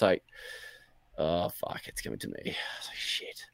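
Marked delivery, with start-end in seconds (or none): 1.2-3.4 retold under-the-breath cursing, near whisper; hushed dread. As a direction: sink low and very soft in dismay. Sees enemy ship turning toward him.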